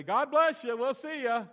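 high, loud, offhand goodbye; breezy shouted farewell. Acted. Churchgoer tossing out a quick blessing, loud and breezy. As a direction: call it out loudly as you head off.

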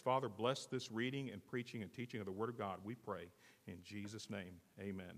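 soft, reverent closing prayer. Acted. Pastor prays over the scripture reading, softly and reverently. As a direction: pray quietly over the reading.

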